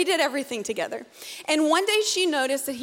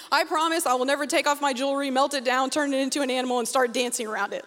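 ordinary talk

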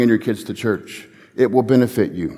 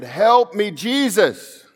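higher pleading call, not yet at full volume; raised plea, first of two; imitated cry for help; 8 semitones higher, much slower than usual. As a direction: cry out to Jesus from a hospital bed. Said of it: "And they got louder and louder".

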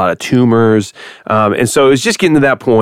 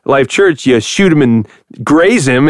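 usual speaking voice

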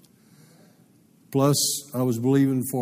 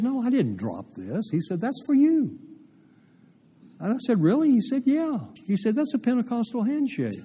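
high, light friendly voice; a kindly stranger explaining the gift; friendly giver; 11 semitones higher than usual. Retold or imitated.

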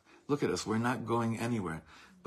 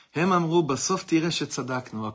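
loud recital; 5 semitones higher, 6 dB louder than usual. Acted. Declaiming the poem's refrain.

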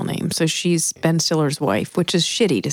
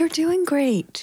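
reassuring; 10 semitones higher, slower than usual. Real, not acted. Reassuring anxious co-host.

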